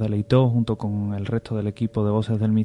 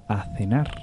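ghostly whisper; 2 semitones lower, 5 dB quieter than usual. Retold or imitated. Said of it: "una voz que diga".

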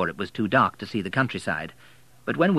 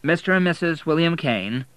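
firm, pitched-up insistence, stressing and; firmly; 7 semitones higher, 3 dB louder than usual. A narrator's reading. William answering the suspicious receptionist, firm. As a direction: set the record straight firmly. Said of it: "said William firmly".

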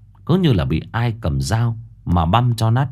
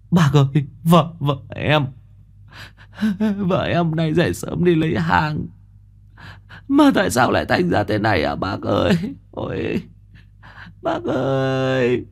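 broken, halting whimper in a higher voice; a man choking back sobs to the elder; 5 semitones higher than usual. A narrator's reading. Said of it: "mếu máo". (giọng mếu máo nghẹn ngào, ngắt quãng)

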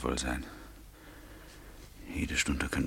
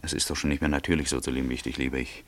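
ordinary talk, nothing marked

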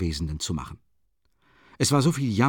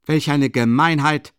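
loud, higher indignant shout from the doorway; loud outcry; 4 semitones higher, 6 dB louder than usual. A narrator's reading. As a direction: hurl the outrage across the room. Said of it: "eine laute Stimme von der Tür her". (laut empörter Ausruf von der Tür)

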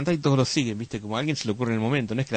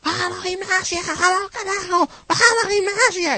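very high, slow, shrill-pitched fan cheer; old lady in the stands screaming for Gimnasia; old woman yelling; 4 dB louder, slower than usual. Retold or imitated. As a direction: screech it like an old lady cheering her team. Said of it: "se pasó todo el partido gritando".

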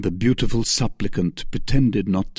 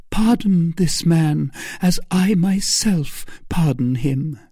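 raised, gently pious voice; angelic meekness; 8 semitones higher than usual. A narrator's reading. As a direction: forgive like a meek saint. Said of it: "an angelic meekness of gesture and tone".

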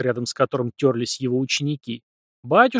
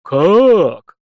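loud, higher shocked "Как?"; shocked gasp; 5 semitones higher, 9 dB louder than usual. A narrator's reading. Priest hearing the director was killed: loud shocked gasp. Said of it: "ахнул не ожидавший подобного поворота событий".

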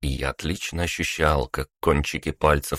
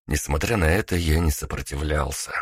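ordinary delivery, nothing marked

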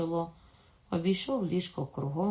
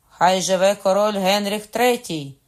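high, very loud, ringing cheer; shouted acclamation; 4 semitones higher, 13 dB louder than usual. A narrator's reading. Katherine proclaims her son king, shouted acclamation. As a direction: hail the new king at full voice. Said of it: "крикнула тоді Катерина".

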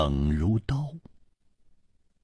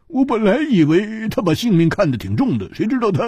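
笑着说; 12 semitones higher, faster than usual. A narrator's reading. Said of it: "胡铁花笑着说".

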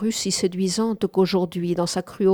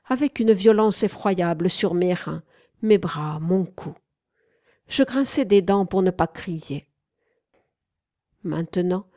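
usual speaking voice

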